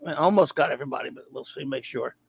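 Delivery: ordinary talk, nothing marked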